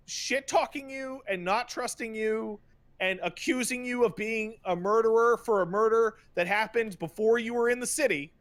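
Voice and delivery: ordinary talk, nothing marked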